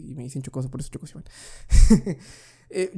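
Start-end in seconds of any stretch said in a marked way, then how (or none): 0.1-1.2 whispered aside. Real, not acted. Self-amused nickname reveal.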